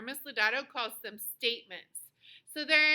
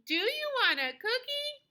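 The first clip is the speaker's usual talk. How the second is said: high-pitched question, climbing on COOKIE; question intonation; 11 semitones higher than usual. Shown as a demonstration. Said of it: "do the whole sentence for a question mark".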